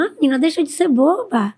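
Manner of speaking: lightly voiced motherly brush-off; mock dismissive mother. Mother waving off daughter's plea, imitated dismissively.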